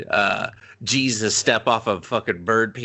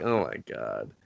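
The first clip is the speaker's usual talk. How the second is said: much lower and softer; low, soft "oh my God"; low dismayed; 12 semitones lower, 9 dB quieter than usual. Retold or imitated. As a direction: mutter it in dismay. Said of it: "They're like".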